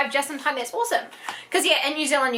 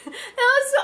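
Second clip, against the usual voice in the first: laughing; 14 semitones higher than usual. Real, not acted. Laughs at the cute keychain.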